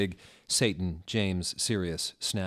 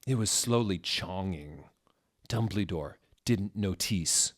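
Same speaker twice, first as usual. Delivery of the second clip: plain reading voice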